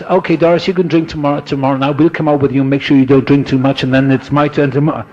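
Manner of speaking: a pal talking Doris into waiting a day, bright and loud; jolly drunk buddy. Pretend. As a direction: ham up a boozy friend making a deal. Imagined drunks bargaining over drinking.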